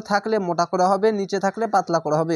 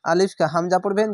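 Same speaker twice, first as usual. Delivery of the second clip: normal talking voice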